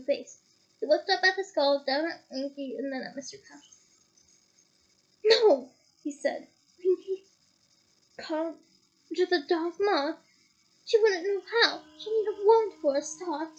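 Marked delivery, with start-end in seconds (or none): 5.2-13.5 raised, louder disbelief; higher, louder startled denial; startled protest. Acted. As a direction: protest that it can't be.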